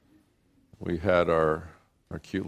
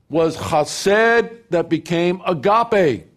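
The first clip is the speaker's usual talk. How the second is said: loud, high, ringing scripture proclamation; full-force preaching; 7 semitones higher, 8 dB louder than usual. Acted. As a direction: proclaim it to the whole congregation. Preacher quotes John 3:16 at full force.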